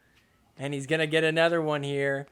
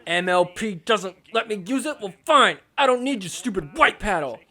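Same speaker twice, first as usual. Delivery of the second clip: mock pissed player; 3 semitones higher than usual. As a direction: act out a miffed player, punching the words. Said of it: "I'd be pissed too".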